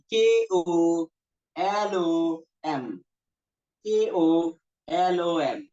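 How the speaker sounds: letter by letter, drawn out with pauses; deliberate, separated letter names; spelling out. Shown as a demonstration. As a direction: spell it slowly for note-takers. Spelling a word for students to write.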